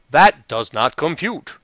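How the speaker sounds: slow, stiff robot monotone. Retold or imitated. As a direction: speak it like a mechanical robot. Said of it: "when the robot said".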